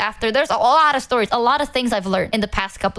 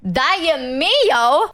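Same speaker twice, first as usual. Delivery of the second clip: startled, higher, caught off guard; surprised; 5 semitones higher, much slower than usual. Real, not acted. Streamer stunned by a fan's cheeky donation message, surprised. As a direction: react like the donation blindsided you.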